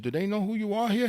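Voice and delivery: can't believe they'd charge him; incredulous. Retold or imitated. Young man asking his friend, hushed and incredulous.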